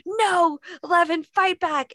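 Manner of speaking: high, loud cry urging her on; shouting encouragement at the TV; cheering shout. Retold or imitated. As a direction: yell at the screen to fight back. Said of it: "I'm like".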